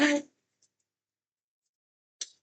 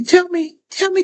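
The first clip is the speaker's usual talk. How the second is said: a sudden eager push on tell; excited burst; 9 semitones higher, 5 dB louder than usual. Real, not acted. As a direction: tell me, sharp, louder and higher with excitement. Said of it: "I'm getting excited".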